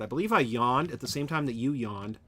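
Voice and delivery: normal talking voice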